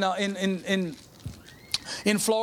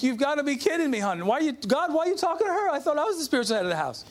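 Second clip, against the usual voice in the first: higher-pitched, incredulous mock complaint; mock protest; 4 semitones higher than usual. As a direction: protest in comic disbelief, pitch jumping up. Jokingly retold protest to wife and God.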